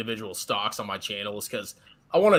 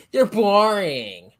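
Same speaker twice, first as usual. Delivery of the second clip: mock whine; 7 semitones higher, 5 dB louder, much slower than usual. Teasing about index funds.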